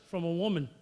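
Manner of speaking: ordinary talk, nothing marked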